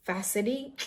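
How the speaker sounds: facady as "FASS-uh-dee"; common mispronunciation. Retold or imitated. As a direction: say facady with an ee ending. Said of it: "Some people pronounce it as".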